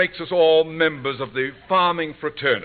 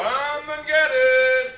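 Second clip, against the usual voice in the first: calling out; 13 semitones higher, much slower than usual. Acted. Calling family to dinner.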